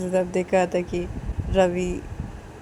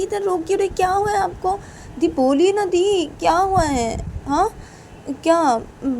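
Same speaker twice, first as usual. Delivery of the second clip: much higher, louder anxious pleading; pleading brother; 9 semitones higher, 4 dB louder than usual. Acted. Brother begs crying sister to tell him, high and anxious. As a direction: keep pressing her to answer, worried. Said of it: "Rahul भी ये बोलता है".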